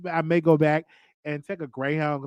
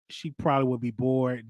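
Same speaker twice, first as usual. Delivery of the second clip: ordinary delivery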